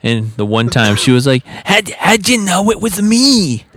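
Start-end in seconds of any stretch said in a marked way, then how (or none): 1.5-3.6 much higher, slow, surprised smoker's voice; raspy smoker lady. Retold or imitated. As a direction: ask it like a gravel-voiced lady caught out. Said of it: "you got a pretty unique voice".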